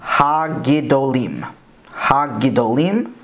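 HA-GIDOLIM with hard g and d; careful demo of Ha-Gidolim as ha-gee-do-leem; modern Hebrew pronunciation. Shown as a demonstration. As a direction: slowly sound out Ha-Gidolim, hard g, plain d. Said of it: "is in modern Hebrew pronounced".

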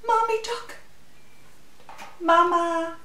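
ordinary delivery, nothing marked

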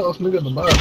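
ordinary talk

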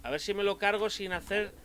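ordinary talk, nothing marked

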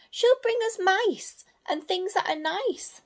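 high, hopeful owl voice; Sarah the owlet. Acted. Sarah comforts the others, high owl voice. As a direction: promise treats brightly. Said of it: "said Sarah".

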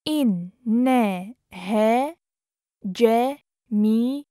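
syllable by syllable. Shown as a demonstration. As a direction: sound it out with long gaps. Teacher breaks phrase into syllables.

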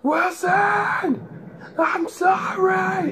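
high, hammed-up movie wail; loud as possible. Bunkmate mocking him in the middle of the night, full-volume shout. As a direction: holler it across the room, stretching the last words. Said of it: "he is loud as he could".

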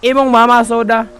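loud exasperated shout. Pretend. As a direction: let it burst out in annoyance. Shouting at annoying customer.

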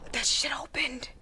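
scared whisper; hushed, frightened whisper. Real, not acted. Whispered after door opens.

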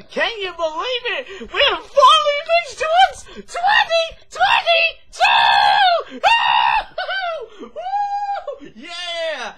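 high, loud, excited cheering; excited shouting. Real, not acted. Celebrating the new year with viewers, shouting with excitement. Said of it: "I don't know why I'm changing voices here".

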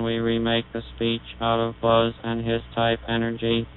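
normal talking voice

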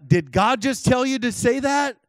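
high, loud retold disbelief; incredulous question. Questioning a prophet after the phone call, incredulous. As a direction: ask it in mock astonishment. Acting out his astonished question.

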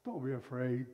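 low, soft, calm assurance; calm reassurance. Acted. Elisha calmly reassures his panicked servant. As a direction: settle the frightened servant gently. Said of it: "as calm as the good morning voice".

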